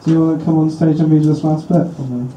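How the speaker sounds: usual speaking voice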